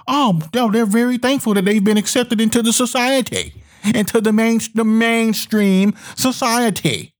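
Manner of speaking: mock patronizing voice, slowing on mainstream society. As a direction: play the smug patron approving. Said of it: "they're looking at you as".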